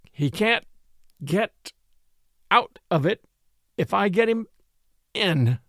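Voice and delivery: broken up by gasps between words; gasping. A narrator's reading. Crosby straining to lift Swallow, gasping out his reply. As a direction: push each word out between breaths. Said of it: "gasped Crosby".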